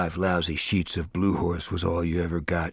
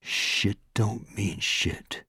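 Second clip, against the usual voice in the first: softer and slow, almost gone; fading mantra; 7 dB quieter, much slower than usual. A narrator's reading. Morris comforting himself, fading whispered mantra. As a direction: let it fade into silence. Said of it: "he told himself".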